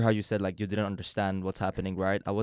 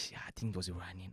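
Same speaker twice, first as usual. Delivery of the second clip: much softer, furtive mock reply; hushed aside; 3 semitones lower, 13 dB quieter, faster than usual. Iranian in a mall discreetly answering another, hushed aside. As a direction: drop right down as if not to be overheard.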